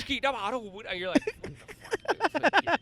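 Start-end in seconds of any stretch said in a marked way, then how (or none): 1.2-2.8 high, slow, drawn-out what the fuck; mock bafflement. Passerby baffled by Dutch speech, high, slow disbelief. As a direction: stretch it out in disbelief. Reacting to overheard language.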